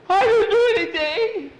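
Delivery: put-on very high, loud, laughing kid voice; high-pitched giggling denial; squeaky boy protest.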